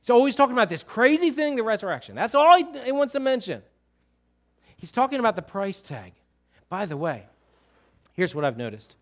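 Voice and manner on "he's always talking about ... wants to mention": high-pitched, bursting preacher's enthusiasm; impassioned, animated preaching; fervent exclamation. Real, not acted. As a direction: burst out with fervour, voice leaping up. Exclaims over Paul's one obsession.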